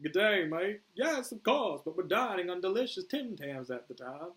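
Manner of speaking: put-on high, loud clumsy Australian accent; bad Australian accent. Boomer excuses his robbery to Flag, bad Australian accent. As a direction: overdo a poor Australian accent. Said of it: "worst Australian accent I've ever done".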